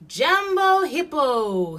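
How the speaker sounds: high, loud, drawn-out greeting; calling out. A narrator's reading. Elephant calls out to Hippo.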